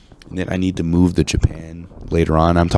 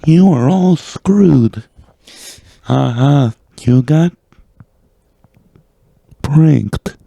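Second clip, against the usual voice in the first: high, loud, slow computer-voice impression; Stephen Hawking; 8 semitones higher, 5 dB louder, much slower than usual. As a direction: imitate a speech synthesizer, halting between words. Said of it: "your guys' Stephen Hawking".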